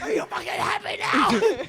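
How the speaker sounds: very high, loud retold outburst; exasperated shout. Snapping at friends after the forced confession, shrieking in exasperation. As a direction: act out cracking under pressure.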